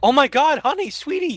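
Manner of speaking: mock-gushing spouse reunion; gushing worried husband. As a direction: gush it out like a relieved husband. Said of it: "instead of like".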